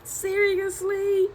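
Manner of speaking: high, slowing seriously; son's put-upon disbelief; mock exasperation. Son facing his classmates, high drawn-out mock exasperation. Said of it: "look at the class like".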